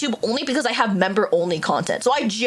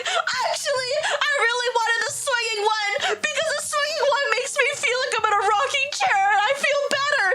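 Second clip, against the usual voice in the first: high, strained wail with a laugh behind it; hammed-up squeaky distress; whining panicked friend; 13 semitones higher than usual. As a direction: mimic a wailing friend. Panicking friend's retold complaint.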